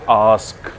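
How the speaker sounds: आस्क with a drawn-out "aa"; teacher modelling आस्क with a long open vowel; long aa sound. Shown as a demonstration. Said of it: "थोड़ी सी long sound के साथ बोलना है".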